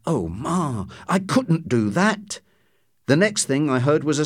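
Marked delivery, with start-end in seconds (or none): 0.0-2.4 a hint of a reluctant young girl; lightly voiced girl. A narrator's reading. Girl protesting to her mother in the back room, lightly voiced. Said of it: "then a girl saying".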